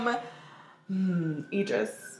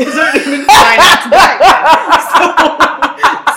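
slow, loud, high words broken by laughter; real laughing; 7 semitones higher, 11 dB louder, much slower than usual. Real, not acted. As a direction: struggle to talk while cracking up. Cracking up at own remark.

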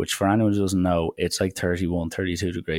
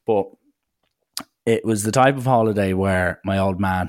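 normal talking voice

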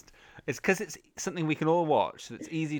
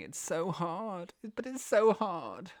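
slow, drawn-out mock lament; hammed-up plaintive catchphrase; running-joke moan; 5 semitones higher, 4 dB quieter, slower than usual. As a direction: stretch it out in mock hardship. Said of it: "it's become a running joke".

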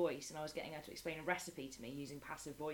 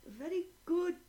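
put-on much higher, rote child's reply; imitating a little boy; 10 semitones higher, 8 dB louder, much slower than usual. Little pupil reciting a textbook answer, high. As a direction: recite it like a memorised lesson.

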